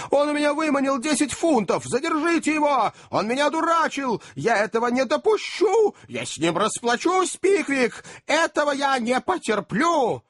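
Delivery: very high-pitched, hysterical ranting; frenzied rage. A narrator's reading. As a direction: rant like a madman, voice far up high. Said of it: "Испуская такого рода бессвязные восклицания".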